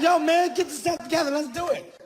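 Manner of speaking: high, eager pep-talk voice; hyped-up friend. Retold or imitated. Friend rallying the group, high and eager. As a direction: pump everyone up, pitched high. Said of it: "Now you like".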